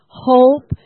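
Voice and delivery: slow and loud, leaning on hope; preacher's loud refrain; loud repeated declaration. Shown as a demonstration. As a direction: declare it again with force. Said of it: "Say it again".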